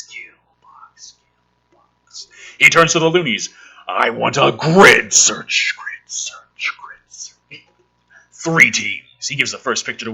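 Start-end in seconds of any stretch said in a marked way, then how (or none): 0.0-2.3 breathy spooky whisper of kill box; whispered echo. Pretend. Mocking the script's drama, whispered echo. 3.9-5.7 mock commanding order. Commander orders, read slowly. 5.8-7.6 spooky whisper of grid search; whispered echo. Pretend. Mocking the commander's order, whispered echo.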